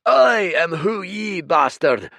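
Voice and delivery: booming creature. Acted. Red giant bellowing at the rowing stranger, furious roar.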